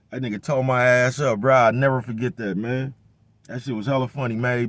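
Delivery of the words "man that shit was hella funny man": usual speaking voice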